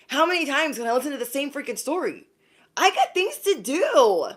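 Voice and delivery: rising to a high, exasperated outburst. Retold or imitated. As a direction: vent fed-up frustration, climbing up. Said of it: "And you're just like".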